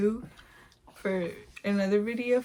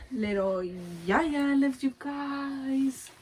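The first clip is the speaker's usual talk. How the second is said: put-on slow, cutesy voice for the baby; 4 semitones higher, much slower than usual. Mom speaking for baby Yaya to viewers, cutesy. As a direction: speak for the little one.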